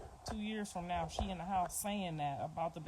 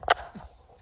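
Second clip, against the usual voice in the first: burst of laughter; 11 dB louder than usual. Real, not acted. Laughs off her own remark.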